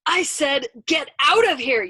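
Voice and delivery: high, loud, insistent yell; desperate shout. A narrator's reading. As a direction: shout it as if near breaking point. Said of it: "Yossarian shouted and broke into tears".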